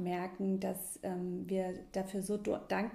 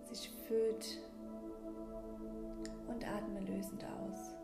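calm breathing guide, gently paced; guided meditation. Acted. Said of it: "in diese kleine Mini-Meditation".

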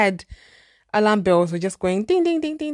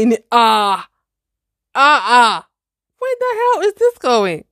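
shocked outburst; 4 semitones higher, 8 dB louder than usual. Retold or imitated. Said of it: "reacting to cook for you".

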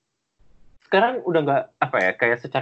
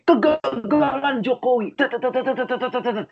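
mock outraged caption; 9 semitones higher than usual. Reading friends' angry captions about Jokowi, mock outraged. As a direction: rattle off a ranting caption, pitched way up. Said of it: "Captionnya udah yang kayak".

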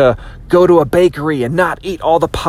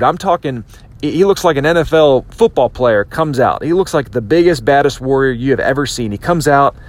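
ordinary talk

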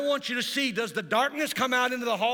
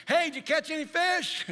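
calling out; 8 semitones higher, 4 dB louder than usual. Acted. Stranger calls out from the shore.

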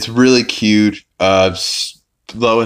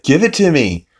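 raised-pitch insistent demand; mildly exasperated retold order; mock demand; 8 semitones higher, 4 dB louder than usual. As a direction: imitate someone demanding it back. Meg demanding her clipboard back.

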